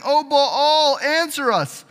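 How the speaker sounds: high, loud, drawn-out plea; acted loud invocation; calling on Baal. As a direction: cry out slowly to a god on high. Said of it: "called upon the name of Baal".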